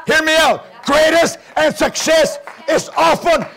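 rising, driving preacher shout; impassioned revival preaching, climbing in pitch; full-force preaching. Real, not acted. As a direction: build relentlessly into a fervent plea to God.

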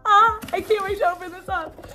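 high, eager squeal of anticipation; excited squeal. Real, not acted. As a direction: squeal with impatience to open it. Eager to open skincare order.

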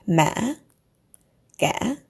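Ma and ga dip and rise; questioning Ma, ga. Shown as a demonstration. Teacher gives question-tone examples. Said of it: "your voice goes up like you're asking a question".

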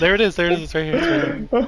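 loud, high excited calling; excited shout. Real, not acted. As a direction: yell it out as you spot it. Excited find in game.

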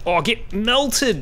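gloating taunt. Real, not acted. Taunting enemy in fight.

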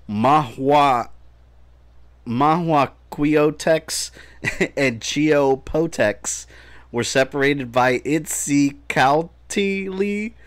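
slow, halting stab at Mahuaquiotex and Itzicaltili, stretching each part; struggling pronunciation. Real, not acted. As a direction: sound out Mahua and Itzicaltili slowly, syllable by syllable. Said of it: "I'm sure I pronounced that".

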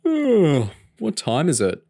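groaning through a yawn; sleepy yawn on waking. A narrator's reading. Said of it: "James yawned as he sat up".

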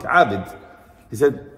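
normal talking voice

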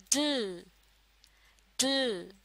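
normal talking voice

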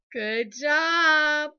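loud, stretched-out praise. Real, not acted. Teacher cheering Ella's spelling, loud praise. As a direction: boom out the praise.

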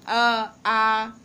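अ clipped short, आ held loud; short then long vowel. Shown as a demonstration. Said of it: "practice करके देखो".

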